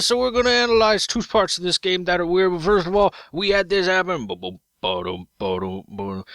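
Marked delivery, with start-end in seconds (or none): 4.3-6.2 deep, soft boom ba-dum beats; low drum beat. Pretend. Voicing a dramatic drum intro, deep beat. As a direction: thump out a low drumbeat. Imitated drum sound effect.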